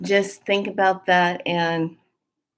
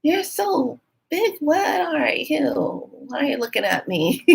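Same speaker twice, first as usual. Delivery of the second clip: high, slow wonder at something huge; awed surprise; 9 semitones higher, slower than usual. Retold or imitated. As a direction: gasp it out in amazement. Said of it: "and I said".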